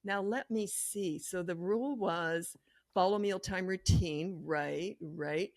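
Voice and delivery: slow, musing over the board; as if you've never seen it before; calm puzzled reading; slow pondering. Shown as a demonstration. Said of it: "You just go like this".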